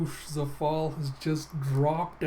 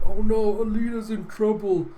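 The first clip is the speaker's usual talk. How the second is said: higher, louder alarmed character voice; worried Teagle; 6 semitones higher, 7 dB louder than usual. Acted. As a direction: fret out loud in mock alarm. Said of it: "then you have Teagle going".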